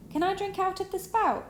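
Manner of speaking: high squeaky delighted voice; tiny pleased mouse. A narrator's reading. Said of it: "said the tiny mouse, pleased".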